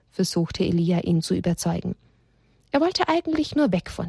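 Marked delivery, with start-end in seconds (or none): none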